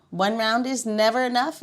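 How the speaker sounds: ordinary talk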